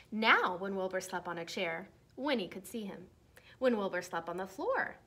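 lively storybook read-aloud voice; reading to children. Acted. Teacher reading a Halloween book to her class, lively read-aloud.

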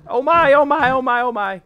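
loud, high frantic repetition; panicked. Real, not acted. Player fleeing a monster, panicked. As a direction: cry it over and over in alarm. Chased in game.

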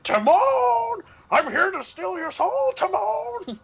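very high, wailed warthog shout; Pumbaa. Acted. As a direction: wail it out like a giant cartoon villain. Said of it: "like, the Pumbaa voice".

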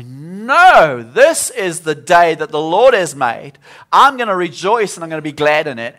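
loud faith-filled proclamation; bold declaration. Retold or imitated. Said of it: "something just rises up".

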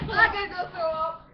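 laughing and yelling, about to gag; loud laughing shout. Real, not acted. Gagging after sour candy.

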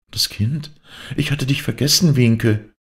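put-on mother suddenly remembering her child; startled cry. A narrator's reading. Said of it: "rief sie".

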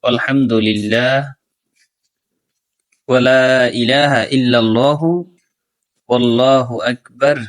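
slow, drawn-out Arabic recitation. Shown as a demonstration. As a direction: repeat it slowly for learners. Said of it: "আবার বলে দিচ্ছি". (আবার ধীরে তিলাওয়াত)

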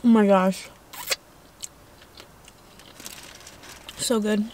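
chewing while talking; muffled by food; mouth full. Real, not acted. Seen 1: eats burger, chews between bites and licks fingers throughout.

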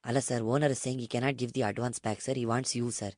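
low, soft, quick deferential patter; meek broker. Acted. Broker passes on owner's odd proposal, meek low murmur. Prank caller as broker Nagesh.

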